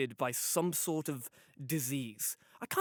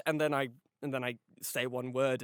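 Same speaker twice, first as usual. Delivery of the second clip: normal talking voice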